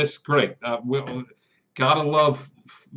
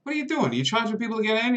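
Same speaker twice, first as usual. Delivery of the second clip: much higher, fast alarmed guard; surprised guard; 9 semitones higher, much faster than usual. Acted. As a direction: blurt it out, startled. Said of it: "And he's like".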